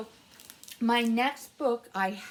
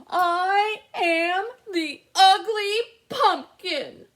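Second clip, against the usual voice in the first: slow, high, loud anguished shout; shouted to the sky; 8 semitones higher, 11 dB louder, slower than usual. Acted. Lonely pumpkin shouting his despair to the sky. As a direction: cry it up to the sky. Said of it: "I shouted to the sky".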